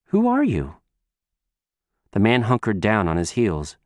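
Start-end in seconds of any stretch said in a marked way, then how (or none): 0.1-0.8 put-on little girl voice. Child voice continues.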